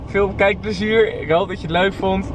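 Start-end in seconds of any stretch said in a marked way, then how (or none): 0.1-1.2 vlog outro. Acted. Outro to viewers.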